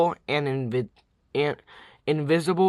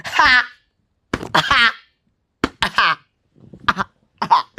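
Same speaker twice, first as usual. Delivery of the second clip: forced laugh; 6 semitones higher, 5 dB louder, much slower than usual. Pretend. Reactor milking their own bad pun, forced laugh. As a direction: separate loud "ha" bursts, fading.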